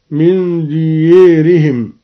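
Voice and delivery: slowly, ديارهم as "diyērihim"; imala. Shown as a demonstration. Teacher showing al-Duri's imala, ديارهم said "diyērihim". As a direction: tilt the long a of ديارهم towards "ē". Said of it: "قرأ الدوري بالإمالة".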